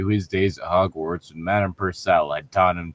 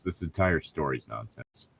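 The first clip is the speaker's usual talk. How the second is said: much softer, lower dry remark; muttered aside; 4 semitones lower, 9 dB quieter than usual. Real, not acted. Co-host grumbling an aside about the fanfic, muttered. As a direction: drop it under your breath.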